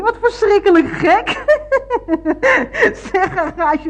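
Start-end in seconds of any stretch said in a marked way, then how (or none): 0.0-1.3 laughing, high and loud; amused. Acted. Amused at her own blunder on phone. 1.3-3.1 high, loud giggle; amused laugh. Acted. Amused at her own blunder on phone.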